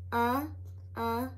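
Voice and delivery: short "Uh, uh" vowel sound, clipped and repeated; sounding out the letter. Shown as a demonstration. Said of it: "Can you sound out the letter U?".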